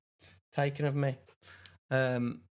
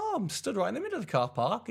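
chirpy high; 8 semitones higher, faster than usual. Retold or imitated. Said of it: "I was like".

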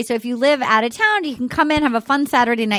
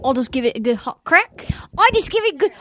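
rising higher, a bad Australian accent drifting Scottish; 9 semitones higher than usual. Shown as a demonstration. [name] attempts the Bachelor line in a bad Australian accent. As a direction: push an Aussie accent that keeps slipping toward Scottish. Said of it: "It's like Scottish or something".

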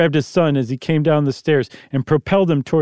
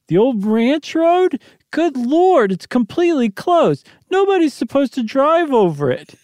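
alarmed wife; 11 semitones higher than usual. Acted. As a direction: protest high and stunned. Janet's voice in the story.

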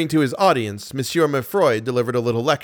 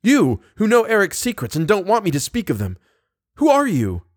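high, agitated outburst; feverish, high-pitched demand; feverish cry; 8 semitones higher than usual. A narrator's reading. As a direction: press the stranger urgently, pitched well up. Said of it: "he cried in a feverish voice".

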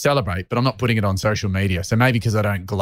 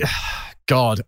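ordinary talk

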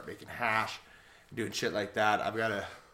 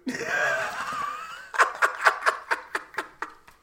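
long squealing burst of laughter; high wheezing laugh; 4 dB louder than usual. Real, not acted. Laughing at the bong hit.

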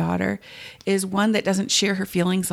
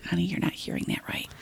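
quick, much softer whisper; imagined reply; 7 dB quieter, faster than usual. Retold or imitated. Husband dismissing her feeling, quick and whispered. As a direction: brush it off in a hurried whisper. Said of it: "he's gonna say".

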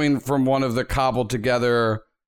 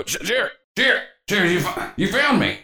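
higher, halting voice from a robot; father's robot voice; 6 semitones higher than usual. Acted. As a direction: stammer it out high, like a father reaching through a machine. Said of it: "start to hear this robot just go".

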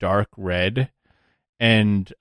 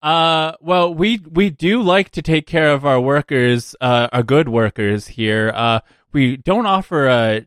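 hesitant, slightly higher company line; a touch of evasive HR politeness; lightly voiced recruiter; 5 semitones higher than usual. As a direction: lightly play a recruiter dodging a question.

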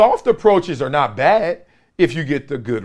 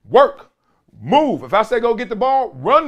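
coach yell; 8 semitones higher than usual. Retold or imitated. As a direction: bark it at the players. Coach yelling at kids.

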